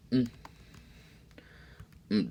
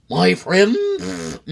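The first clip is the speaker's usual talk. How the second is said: high, loud showman's greeting; clear clean salesman; 13 semitones higher, 8 dB louder than usual. Acted. McBean greeting the sneetches, high showman voice. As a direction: hail the crowd like a smooth peddler. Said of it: "in a voice clear and clean".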